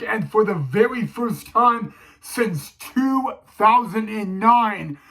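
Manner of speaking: ordinary talk, nothing marked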